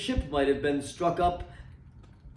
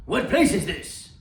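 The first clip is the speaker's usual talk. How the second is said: Scrooge asking; 3 semitones higher, 3 dB louder than usual. A narrator's reading. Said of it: "asked Scrooge".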